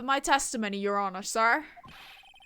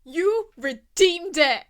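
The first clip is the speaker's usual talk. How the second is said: slow, loud redo; 4 semitones higher, 7 dB louder, slower than usual. Acted. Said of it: "repeats line in a voice".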